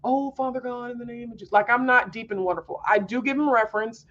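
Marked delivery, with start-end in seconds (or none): 0.0-1.5 mock formal prayer. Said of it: "I don't talk to God like".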